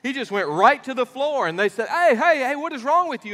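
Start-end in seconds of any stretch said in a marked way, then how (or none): 1.9-3.3 higher, louder "hey, hey"; alarmed shouting, playfully acted; calling out to fainted man. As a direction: rouse a man off the floor. Fathers reviving fainted man.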